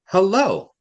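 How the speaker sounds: "hello" with a high, loud second syllable; stress on second syllable. Shown as a demonstration. Teacher shows an accent, "hello" stressed on "lo". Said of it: "the accent is on the low".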